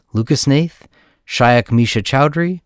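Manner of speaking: ordinary talk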